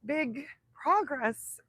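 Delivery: ordinary delivery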